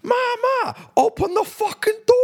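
very high, exasperated mock Jersey shout; Jersey accent. Man locked out of mum's car, high Jersey-accented yell. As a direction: imitate a Jersey son yelling at his mum. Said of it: "that's my Jersey accent".

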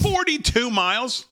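high, drawn-out stunned shout; can't believe the number you just heard; shocked disbelief. Real, not acted. Co-host reacts to a forty-two mile bike ride, shocked shout.

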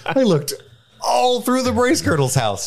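high, frantic hobbit voice; high-pitched mock Frodo; Frodo quote.

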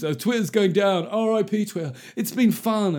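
mock dramatic farewell to Twitter; melodramatic tweeter. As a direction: ham up the Twitter mourners. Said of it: "Twitter being melodramatic".

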